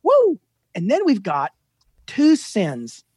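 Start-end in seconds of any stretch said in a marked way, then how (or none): 0.0-0.4 high, loud "woo"; mock freak-out whoop. Father imitating his own freak-out, high whoop.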